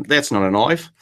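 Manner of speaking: lightly put-on Aussie accent, mimicking a kid; mock Aussie twang; imitated Australian accent. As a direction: do a child's picked-up Australian accent. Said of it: "picked up an accent".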